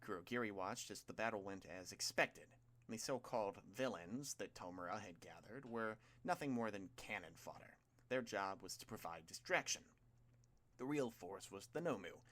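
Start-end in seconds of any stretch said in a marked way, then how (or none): none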